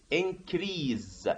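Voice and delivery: "increase" ending in a buzzed Z; increase with Z sound. Retold or imitated. Teacher quotes a common mistake, "increase" ending in Z. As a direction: say "increase" as "in-creeze". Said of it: "A lot of teachers also say".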